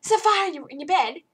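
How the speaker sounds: loud, high alarmed question; dad alarmed. Retold or imitated. Dad rushing in, loud alarmed question. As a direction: burst in, worried and loud. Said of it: "He's like".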